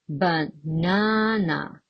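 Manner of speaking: baNAna, slow with a long NA; stress on second syllable. Shown as a demonstration. Teacher showing where stress falls, slow second-syllable stress. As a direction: draw out the middle of Banana. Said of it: "It falls on the second syllable".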